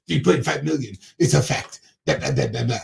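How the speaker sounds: spout it over someone, a hint of mimicry; Trump spouting. Imitating Trump butting in on Biden's answer, lightly mock spouting. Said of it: "Trump's over your shoulder fucking just spouting".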